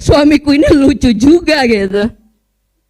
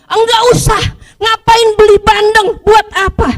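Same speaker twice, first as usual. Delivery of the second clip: husband brushing her off, higher and sharp; annoyed refusal; 6 semitones higher, 3 dB louder than usual. Retold or imitated. Husband refusing to stop for fish, irritated and high. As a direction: snap back a grumpy no. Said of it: "Tapi selalu dengan marah dulu".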